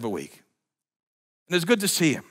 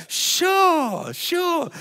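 high, strained mock yes; squeaky bluffing yeses; fake agreement. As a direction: pretend you remember. Faking memory again.

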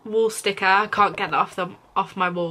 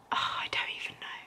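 real whispered give-up; 15 dB quieter, faster than usual. Real, not acted. Whispered to the camera, giving up on the word. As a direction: breathe it out, voice gone.

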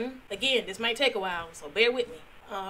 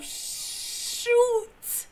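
usual speaking voice

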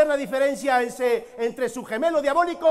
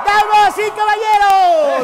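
slow booming ring call, high; lucha libre announcer; 5 semitones higher, 7 dB louder, slower than usual. Acted. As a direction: drag out each word for the arena. Spanish intro of Big Man.

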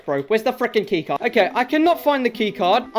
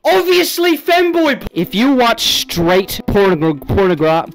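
loud stammer; 5 dB louder than usual. Real, not acted.

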